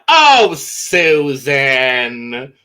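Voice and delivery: very slow, stretched Oh Susan; mock disappointed mother; drawn-out scold. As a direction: drag it out disappointed. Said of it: "imagine if I was just like".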